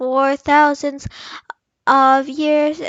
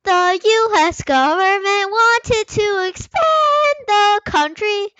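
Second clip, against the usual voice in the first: hamming up the textbook, half singing; child mock-performing a history text in a silly high voice; silly sing-song reading; 6 semitones higher, 4 dB louder than usual. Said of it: "Can you just, uh, focus?".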